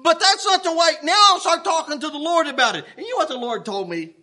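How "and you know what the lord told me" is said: usual speaking voice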